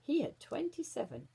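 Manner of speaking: ordinary delivery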